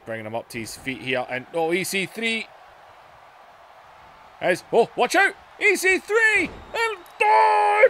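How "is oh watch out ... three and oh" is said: rising, louder and higher play-by-play; excited call. Real, not acted. Commentator calling the slam to viewers, excited rise. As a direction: call the big move as it lands. Commentator sees the big move.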